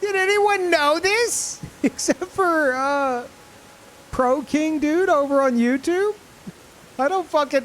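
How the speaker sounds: can't believe nobody said it; stunned disbelief. Real, not acted. Stunned by new game info.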